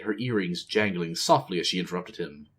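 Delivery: plain reading voice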